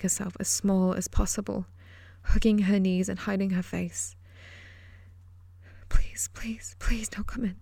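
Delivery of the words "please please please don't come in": hushed, desperate whispered pleading; frightened child whispering a prayer. Acted. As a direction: beg under your breath so nobody hears. Child hiding, begging not found.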